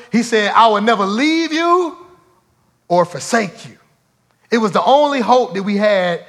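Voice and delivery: loud, rising preacher's build; preaching. Acted. Preacher builds to full force.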